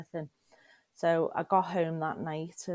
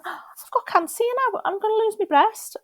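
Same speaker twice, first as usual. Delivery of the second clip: much higher, louder chirpy tone, as if trivial; breezy like it's normal; 12 dB louder than usual. Retold or imitated. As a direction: announce it cheerfully like everyday news. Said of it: "just saying it like it was normal".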